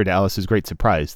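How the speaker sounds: usual speaking voice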